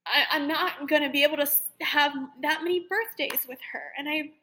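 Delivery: half-crying as she retells it; choked-up plea to her mom; tearful. Retold or imitated. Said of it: "started bawling my eyes out".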